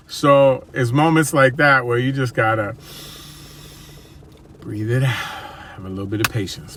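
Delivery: ordinary talk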